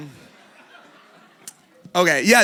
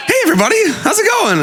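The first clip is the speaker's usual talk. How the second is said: much higher, laughing cheery hello; laughing self-parody of a sing-song welcome; own trademark greeting; 5 dB louder, faster than usual. Shown as a demonstration. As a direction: replay your own greeting to test it, through laughter. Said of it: "Do I, do I sound like that?".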